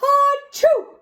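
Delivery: a sudden squeaky high achoo; high sneeze. Acted. Teacher acting Peter's sneeze for the class. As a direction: burst out with a loud, high sneeze. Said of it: "Peter sneezed".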